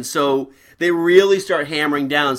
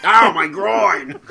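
slow pained cry; just hit in the groin; 4 semitones higher, 4 dB louder, much slower than usual. Retold or imitated. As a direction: moan it like a hurt actor. Same quote repeated.

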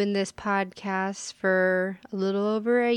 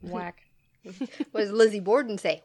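usual speaking voice

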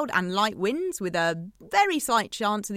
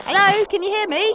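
louder, higher, straining to reach the caller; calling out louder; 9 semitones higher, 8 dB louder than usual. Real, not acted. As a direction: call out down a bad line.

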